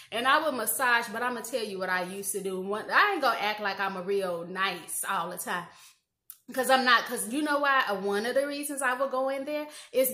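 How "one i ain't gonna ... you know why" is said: ordinary talk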